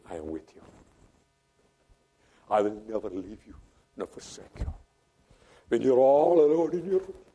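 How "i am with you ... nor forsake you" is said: soft, hushed voice of God, trailing to a whisper; gentle voice. Acted. Preacher voicing God's gentle voice to the lonely listener, soft and hushed. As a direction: speak it softly like a comforting presence. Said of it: "wait for the gentle voice".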